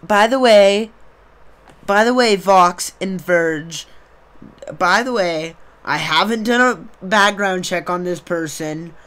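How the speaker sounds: hammed-up over-cautious YouTuber announcement; PewDiePie. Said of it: "he always has to be like".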